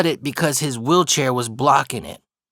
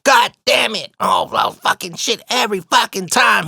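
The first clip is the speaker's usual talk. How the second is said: high-pitched mock furious outburst; cussing up a storm; 9 semitones higher, 3 dB louder than usual. Man struggling into the smaller stall, imitated angry cursing. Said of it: "He's just cussing up a storm".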